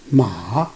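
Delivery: "Ma" dipped low before it rises; third tone. Shown as a demonstration. Teacher showing the third tone, "Ma" falling then rising. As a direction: take "Ma" down, then up. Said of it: "coming down and then go up".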